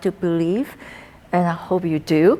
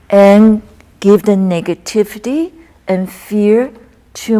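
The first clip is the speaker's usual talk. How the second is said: recited qigong poem; 5 dB louder than usual. Acted. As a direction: intone each line as a healing verse.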